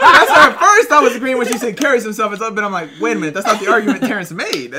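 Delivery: laughing while explaining. Real, not acted. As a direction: crack up mid-sentence.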